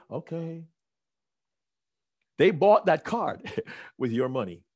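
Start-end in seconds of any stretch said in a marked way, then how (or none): 0.1-0.6 small, hushed okay; much softer, sheepish okay; meek okay. Retold or imitated. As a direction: give in quietly. Meek reply to wife.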